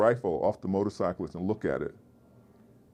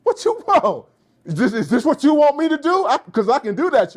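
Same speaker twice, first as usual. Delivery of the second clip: much higher and louder mock street guy; exaggerated street voice; 8 dB louder than usual. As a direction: ham up a loud, high, jive-talking dude. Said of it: "and I went".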